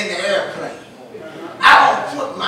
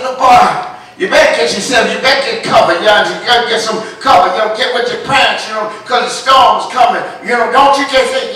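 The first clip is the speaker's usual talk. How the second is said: louder, faster pleading warning; loud urging; 8 dB louder, faster than usual. Retold or imitated. Second man urging the boy to take cover, loud. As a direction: hurry the boy along. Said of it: "Another man come on and said".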